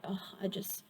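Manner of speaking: drowsy whispered sigh of giving up; tired whisper. Retold or imitated. Viewer imagining wanting a nap, in a tired whisper. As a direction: breathe it out like you're ready to nap. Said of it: "sometimes you might be like".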